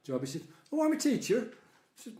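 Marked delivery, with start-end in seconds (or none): 0.7-1.5 imitated Irish accent, pitched higher; an Irish lilt on the reply; Irish-accented friend, higher voice. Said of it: "the most wonderful Irish accent".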